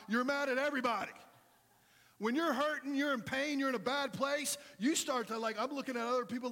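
high, loud preaching cry; a pastor firing up the congregation, loud and high; preaching at full force. Acted. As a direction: preach it out hard to the crowd. Preacher builds to a shout.

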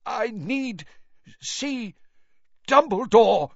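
high, strained, halting gasps; desperate, broken struggle to speak; laboured effort. A narrator's reading. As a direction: force out each word with a pause, then burst out the last. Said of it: "Every word he spoke seemed to cost him a terrible effort".

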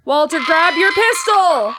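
high, loud, slowed yell; scared shout. Real, not acted. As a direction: scream advice at the screen. Yelling at character in fear.

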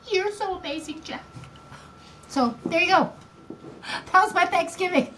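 high, emotional voice; choked up. Real, not acted. Grandmother ending her grief story, choked up. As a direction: pitch climbs as the feeling takes over.

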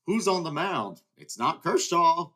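can't believe who's pitching; shocked disbelief. Real, not acted.

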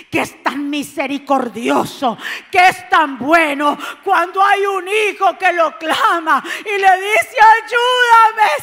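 loud, crying fervent preaching; preacher crying out to the congregation; preaching at full force. Acted. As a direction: shout the promise of the Father.

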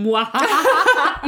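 loud drawn-out muahaha cackle; unhinged evil laugh. Pretend. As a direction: cackle like a cartoon villain. Mock reaction to transcript.

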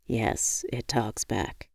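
soft, low, amused reply; calm and amused. Acted. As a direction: answer gently, half-laughing. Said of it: "He laughed".

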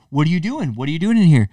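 mock confrontation; confronting. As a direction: catch them in the act. Confronting cheaters, retold.

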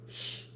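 sharp, soft breathy shush; quick hissed hush. A narrator's reading. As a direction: hiss at him to hush. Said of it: "hissed Professor McGonagall".

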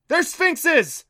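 loud, high urgent shout; full-voiced yelled reply; calling back. A narrator's reading. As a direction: shout the answer back over the chase. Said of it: "Adam called back".

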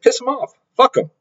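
fired-up retold brush-off; emphatic retold exclamation. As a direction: toss it off high and defiant. Retelling advice to gym guys.

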